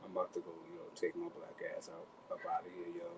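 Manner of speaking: low, quiet macho voice; understated mock tough-guy voice; putting on masculine. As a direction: play it cool and manly. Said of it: "let me get masculine now".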